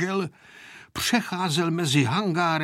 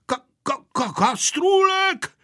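stammering; 12 semitones higher, 3 dB louder than usual. A narrator's reading. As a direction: stammer on the first syllable, then stretch the word out. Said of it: "koktal dědeček". (koktavě, překvapeným vysokým hlasem)

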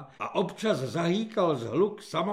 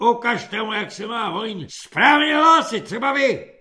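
raised commanding voice; loud order; 5 semitones higher, 9 dB louder than usual. A narrator's reading. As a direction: point at a recruit and bark. Corporal orders a demonstration.

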